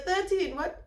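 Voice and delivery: normal talking voice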